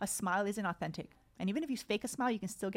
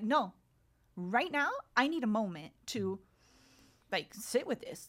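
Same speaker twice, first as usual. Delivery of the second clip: louder, firm retold refusal; 2 semitones higher, 3 dB louder than usual. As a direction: push back firmly, louder on the first word. Retells sending husband out of room.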